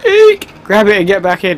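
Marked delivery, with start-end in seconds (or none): 0.1-0.5 high, loud squeak of fright; startled shriek. Real, not acted. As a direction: yelp like you got jumped. Sudden scare.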